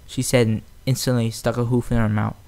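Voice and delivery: ordinary delivery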